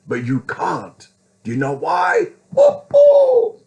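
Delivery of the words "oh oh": very high, loud "oh, oh"; high loud whoop. Acted. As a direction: let out a piercing high whoop. Mock cry within heated rant.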